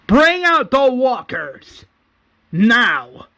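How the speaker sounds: loud, drawn-out command, high then dropping; shouted order. Acted. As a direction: call it out across an arena. Acting governor shouting an order.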